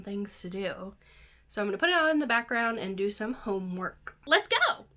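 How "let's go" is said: high, loud burst of hype; excited cheer. Real, not acted. Vlogger starting homework with the show on, excited cheer. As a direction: shout it high to psych yourself up.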